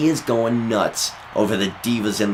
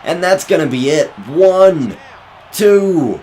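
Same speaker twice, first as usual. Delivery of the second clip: high, loud ringside shout; excited commentator; 6 semitones higher, 4 dB louder than usual. Acted. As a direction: sell the big move to the crowd. Big move and near fall.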